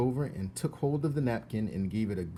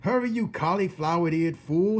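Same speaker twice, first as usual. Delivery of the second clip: higher, loud insulting shout; 8 semitones higher, 8 dB louder than usual. Acted. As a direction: yell it with scorn. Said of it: "shouted Cook".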